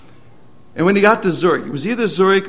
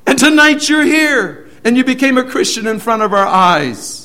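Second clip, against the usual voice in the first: amazed; 9 semitones higher, 8 dB louder than usual. Retold or imitated. Guard tells the convert, amazed.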